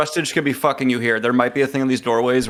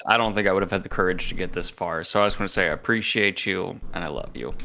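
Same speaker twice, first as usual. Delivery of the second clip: soft, heartfelt thanks fading to a hush; tender confession, getting quieter; sincere; 4 semitones lower, 6 dB quieter than usual. Acted.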